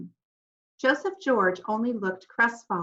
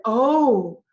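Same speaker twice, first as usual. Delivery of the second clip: loud startled oh; all gasp at the news; shocked gasp; 2 semitones higher, 8 dB louder than usual. A narrator's reading. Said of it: "We all said".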